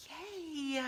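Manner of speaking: mock-tiny yay, soft and high; quiet, high-pitched little cheer, drawn out; husband's muted version of extreme excitement; imitating husband's understated excitement. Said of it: "this is extreme excitement".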